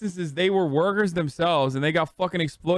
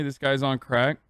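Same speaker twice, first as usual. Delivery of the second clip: ordinary talk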